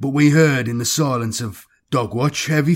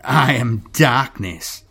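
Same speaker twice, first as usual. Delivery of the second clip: slow, lightly voiced menacing creature; malicious creature; slower than usual. A narrator's reading. Shapeshifting creature answers the terrified gang leader, with slow malice. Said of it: "the creature laughed maliciously".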